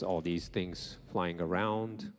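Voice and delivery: normal talking voice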